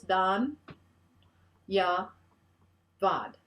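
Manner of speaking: Dhan, ya, Vad split into three slow separate syllables. Shown as a demonstration. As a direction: break Dhanyavad apart, a pause after each syllable. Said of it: "It's three syllables".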